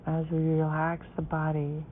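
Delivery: guided meditation. Acted.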